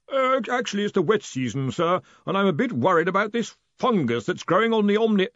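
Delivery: high, worried character voice; meek distant underling. A narrator's reading. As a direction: hesitantly correct your superior.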